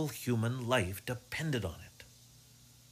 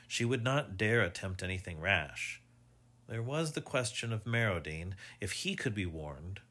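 ordinary delivery, nothing marked

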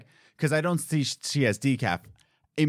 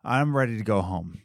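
ordinary talk, nothing marked

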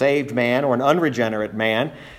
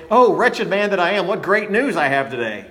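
mock cheerful delivery; 5 semitones higher than usual. Shown as a demonstration. Preacher showing how Paul is not saying it, mock cheerful. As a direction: toss it off like happy news, hammed-up. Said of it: "he's not saying it".

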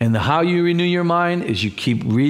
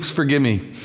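usual speaking voice

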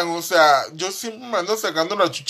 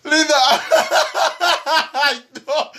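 hablando entre carcajadas; riendo; 10 semitones higher, 3 dB louder than usual. Real, not acted. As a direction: palabras rotas por la risa. Ataque de risa. (entre risas)

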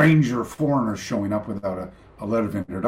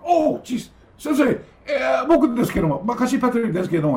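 much higher, apologetic, deferential; flustered student; 12 semitones higher, faster than usual. Retold or imitated. Surprised student apologizes to his sensei, flustered. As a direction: caught off guard by the sensei. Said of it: "and I went".

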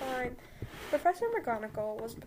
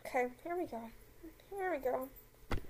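usual speaking voice

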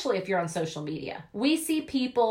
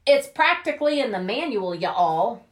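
ordinary delivery

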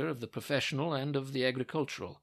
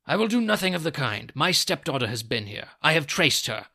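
put-on loud, indignant old man; forceful, angry outburst; angry confrontation; 8 dB louder than usual. A narrator's reading. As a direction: refuse loudly and accuse. Furious stepfather confronts Holmes.

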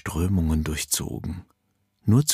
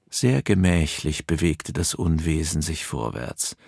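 ordinary delivery, nothing marked

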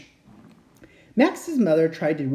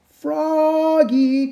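higher, louder drawn-out call; 11 semitones higher, 5 dB louder than usual. Acted. Mom calling Froggy from far, loud and high. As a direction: call him from the house. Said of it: "called his mom".

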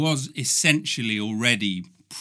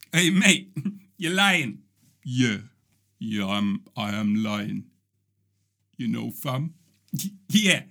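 London street slang. Pretend. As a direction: play two London teens chatting. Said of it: "that's a little scene".

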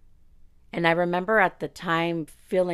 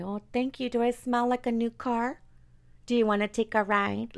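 higher, sugary mock-innocent reply; 7 semitones higher than usual. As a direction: play the naive girl sarcastically. Sarcastic imagined girl reply.

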